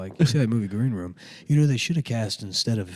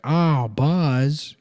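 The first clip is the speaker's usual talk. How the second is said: higher, louder botched movie-star impression; bad Tom Hanks; 4 semitones higher, 4 dB louder than usual. Comic mocking a friend's failed impression, exaggerated catchphrase. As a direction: mimic a poor impressionist doing a film catchphrase. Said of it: "that's not Tom Hanks".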